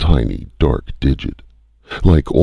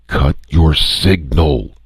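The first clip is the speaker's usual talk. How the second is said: snapped angrily; 5 semitones higher, slower than usual. A narrator's reading. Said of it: "The other snapped angrily".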